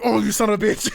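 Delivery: high, loud, laughing curse; re-enacted indignant outburst; mock outrage. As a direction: curse in comic exasperation, near laughter. Dad's retort after cold hands.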